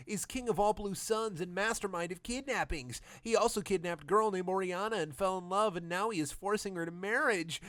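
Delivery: lightly hammed villager informant. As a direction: explain it in a high put-on voice. Villager explains, put-on voice.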